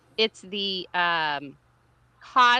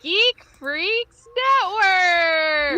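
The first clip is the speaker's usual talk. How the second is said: slow, loud, high proclamation; dramatic shout; 8 semitones higher, 8 dB louder, much slower than usual. Acted. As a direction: bellow it out dramatically. Show intro shout.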